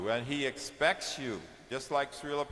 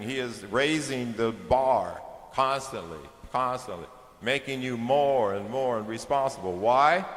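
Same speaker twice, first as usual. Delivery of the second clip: louder, driving sermon voice; forceful preaching; 5 dB louder than usual. Acted. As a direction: press the point home to the crowd.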